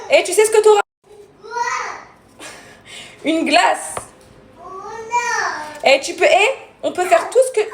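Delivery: mother bribing son with treats, enticing. Real, not acted. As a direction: dangle the treat to win him over. Bribing son with ice cream.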